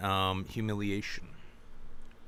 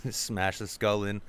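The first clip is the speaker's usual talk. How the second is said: ordinary talk, nothing marked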